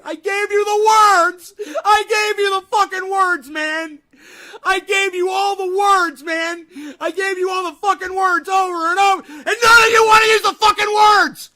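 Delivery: loud, crying out in frustration; anguished yelling. Real, not acted. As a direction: shout like he's at breaking point. Rant at players ignoring words.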